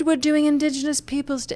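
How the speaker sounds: a hint of a put-on activist voice; mock left-winger. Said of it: "if we're on the left".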